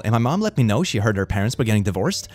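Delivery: usual speaking voice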